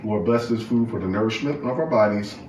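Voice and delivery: soft, reverent grace before the meal; bow your head and bless the food softly; mealtime prayer. Real, not acted.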